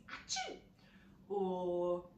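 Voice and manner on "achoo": fake sneeze. Shown as a demonstration. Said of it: "do a big sneeze".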